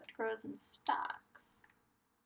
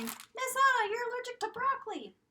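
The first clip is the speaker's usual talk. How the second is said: put-on high, loud child's voice; squeaky pretend kid calling out to the teacher; voicing a child; 14 semitones higher, 6 dB louder than usual. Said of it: "If you're saying".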